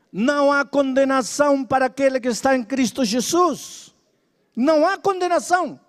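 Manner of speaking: proclamação solene de pregador; preaching proclamation. Acted. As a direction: proclaim it to the whole church. Climactic preaching on Romans.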